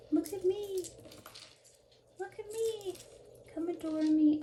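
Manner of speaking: high little voice begging for attention; a necklace pleading to be admired, sing-song high. Pretend. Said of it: "and just say".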